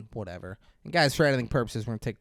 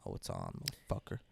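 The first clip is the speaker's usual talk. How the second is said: low menacing threat; 16 dB quieter, faster than usual. Retold or imitated. Acted silent inner threat.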